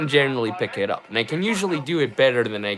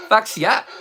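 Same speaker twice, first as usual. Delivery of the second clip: put-on higher, punchy Australian accent; 7 semitones higher than usual. Vlogger about the movie, a higher punchy Australian accent. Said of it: "My Australian accent isn't great".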